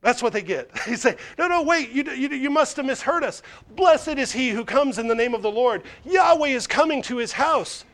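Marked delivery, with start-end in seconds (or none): 1.4-7.8 pleading protest. Acted. Crowd pleads to be heard.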